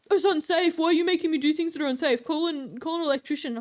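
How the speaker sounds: mock outrage at being asked to do it; indignant caller. Caller voiced in a retold argument.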